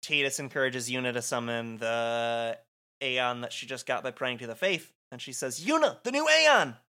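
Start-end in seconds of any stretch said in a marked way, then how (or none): 5.6-6.8 high, loud battle call; urgent shout. Acted. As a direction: shout a prompt across the fight. Urging summoner in battle.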